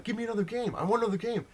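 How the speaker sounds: high-pitched mock tantrum; retold tantrum. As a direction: demand it like a kid flipping out. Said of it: "And he flips out".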